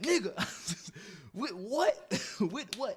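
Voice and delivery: high, laughing disbelief; laughing exasperation. Retold or imitated. To the opponent urging him to punch back, laughing high exasperation. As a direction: laugh it out, helpless.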